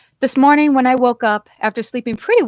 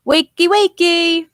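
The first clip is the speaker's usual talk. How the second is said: high, loud, slow sing-song wake-up call; hollering; 10 semitones higher, 7 dB louder, slower than usual. A narrator's reading. Landlord hollering at a sleeping guest, loud and sing-song. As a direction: drag it out to rouse a sleeper. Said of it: "He came in hollering".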